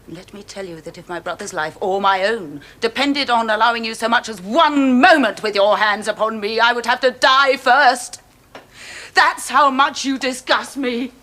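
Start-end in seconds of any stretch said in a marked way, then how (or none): 0.1-1.2 soft, icy scorn; quiet contempt. Acted. Cold contempt toward man. 2.8-11.1 building defiance. Acted. Rising defiance to end.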